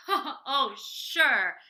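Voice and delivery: loud mocking laugh into the words; big acted guffaw of disbelief; bursting with laughter. A narrator's reading. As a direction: laugh it out, scoffing. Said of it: "bursting with laughter".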